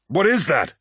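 high, alarmed shout over noise; shouted question. A narrator's reading. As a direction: shout it over a howling wind. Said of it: "he shouted".

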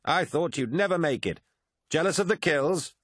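high-pitched cocky taunt; swaggering swordsman. A narrator's reading. Swordsman greets his late-arriving comrade on the roof, swaggering. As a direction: tease a comrade with lifted, swaggering voice. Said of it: "even more of a swagger".

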